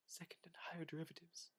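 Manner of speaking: fast unvoiced whisper. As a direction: drop to a secret whisper. Sudden whisper in a reading.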